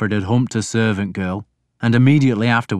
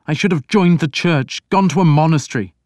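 put-on higher, louder young prince voice; a troubled prince pleading; Alfred, anguished prince; 8 semitones higher, 5 dB louder than usual. A narrator's reading. Reader voicing Alfred to his priest, higher and louder.

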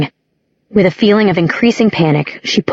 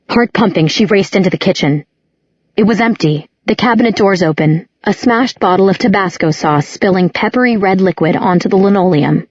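plain reading voice

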